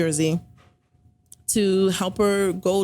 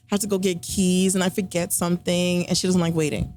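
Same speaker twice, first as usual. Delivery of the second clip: ordinary talk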